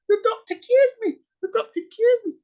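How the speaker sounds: put-on very high falsetto old-lady squeal; hammed-up old patient crowing in a piping falsetto; overjoyed old woman. Cured old patient telling everyone about the doctor, squeaky mock elation. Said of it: "half an hour ago was given the tablets by McCoy".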